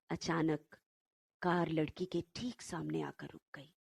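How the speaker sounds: plain reading voice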